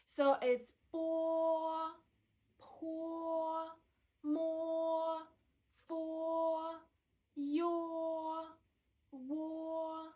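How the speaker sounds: walk through each syllable gently; Mandarin o syllables. Shown as a demonstration. Teacher lists the "o" syllables softly, careful demo. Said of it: "only used with six initials".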